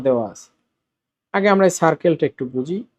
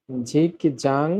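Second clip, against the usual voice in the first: slow, quiet model word; 3 semitones lower, 5 dB quieter, much slower than usual. Shown as a demonstration. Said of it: "উচ্চারণটা হবে কি তাহলে".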